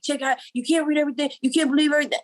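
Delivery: high exasperated scolding, imitating herself; scolding friend. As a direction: tell him off like a fed-up friend. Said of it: "I'm like".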